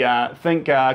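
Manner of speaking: ordinary delivery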